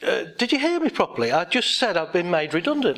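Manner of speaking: incredulous. Retold or imitated. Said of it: "And she went".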